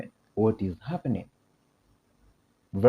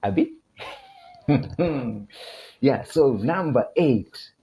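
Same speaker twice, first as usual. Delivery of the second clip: ordinary talk, nothing marked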